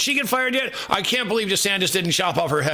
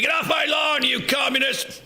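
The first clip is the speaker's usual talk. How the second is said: put-on old man voice; 7 semitones higher than usual. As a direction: shout it like a cranky old geezer. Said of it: "Ready my old man voice".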